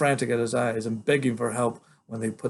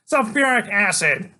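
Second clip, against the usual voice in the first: put-on high, loud cocky little boy; a tiny hero bluffing a killer; bold kid voice; 9 semitones higher, 7 dB louder than usual. Freak bluffing Killer Kane, high and loud. Child voice.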